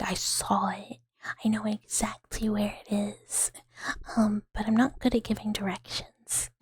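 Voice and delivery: low, breathy near-whisper. Real, not acted. ASMR streamer chatting softly.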